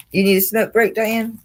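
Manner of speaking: teasingly calling to Diane about a smoke break. Real, not acted. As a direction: call across to Diane.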